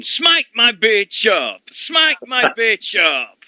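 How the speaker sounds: high, slow drawn-out heckle; mock shout. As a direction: yell it at the ring, stretched out. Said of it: "when I was shouting".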